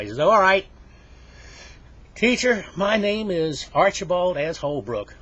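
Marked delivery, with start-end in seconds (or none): 0.2-0.7 high, loud burst of agreement; loudly giving in. Acted. Archie gives in before reciting. 2.2-5.1 loud, high, slow announcement; pupil reciting formally. Acted. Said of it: "I want you to give your name that way".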